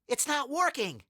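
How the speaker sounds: high-pitched cartoonish panic; dismayed cry. A narrator's reading. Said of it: "cried Harold".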